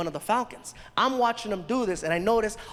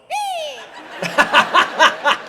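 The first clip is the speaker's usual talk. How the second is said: shrieking burst of laughter; high loud laugh; 10 dB louder than usual. Real, not acted. Laughing at own joke.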